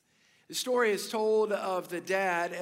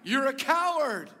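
higher, louder call thrown back; storyteller's mock echo yell; echoed shout; 5 semitones higher, 5 dB louder than usual. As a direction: imitate the echo returning the insult. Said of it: "sure enough back".

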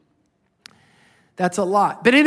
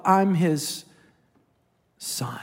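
whispered tender confession; 4 semitones lower, much slower than usual. Real, not acted. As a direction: let the last word fall away in a whisper.

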